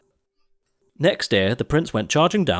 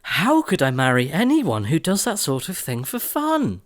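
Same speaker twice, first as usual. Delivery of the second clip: appalled Cinderella; 7 semitones higher than usual. A narrator's reading. Cindy thinking to herself, high and appalled. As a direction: think it in dismay. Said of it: "she thought".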